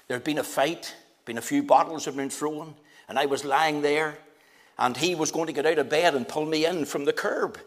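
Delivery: normal talking voice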